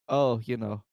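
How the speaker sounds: ordinary talk, nothing marked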